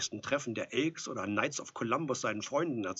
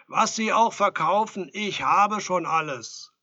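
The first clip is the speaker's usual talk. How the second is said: high, loud brush-off through a door; calling out refusal; 9 semitones higher, 13 dB louder, slower than usual. A narrator's reading. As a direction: shout the salesman away. Edelstein shouts at the door.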